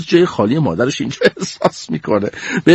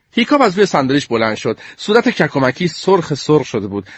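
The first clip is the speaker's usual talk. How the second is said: plain reading voice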